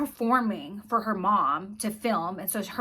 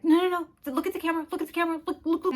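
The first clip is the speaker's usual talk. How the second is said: higher-pitched, insistent imitation of a coaching mom; imitated pushy stage-mom, pitched up; 8 semitones higher, much faster than usual. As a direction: mimic a mother urgently directing her child. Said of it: "she's coaching her son to cry".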